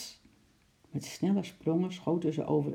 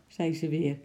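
ordinary delivery, nothing marked

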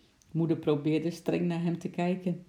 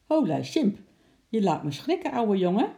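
louder, higher playful start; startled greeting; 5 semitones higher, 5 dB louder than usual. A narrator's reading. Bart to the ape that jumped on him, startled and friendly. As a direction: greet an old pal who made you jump.

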